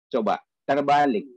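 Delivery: normal talking voice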